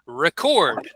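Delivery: record said as ri-CORD, stress on CORD; teacher models record, ri-CORD; model word. Shown as a demonstration. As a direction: lead the class with record as ri-CORD. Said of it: "Let's say this word together".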